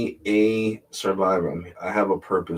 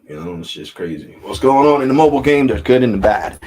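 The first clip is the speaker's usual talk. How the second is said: usual speaking voice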